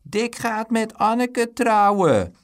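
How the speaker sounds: drawn-out schoolyard jeer, building. A narrator's reading. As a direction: chant the taunt, stretching every word. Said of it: "jouwde het Bruin".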